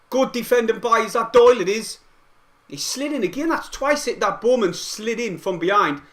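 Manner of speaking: high, loud excited match commentary; live play-by-play. Real, not acted. Fan streamer calling Sunderland's attack, excited play-by-play. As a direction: call the attack urgently, pitch climbing.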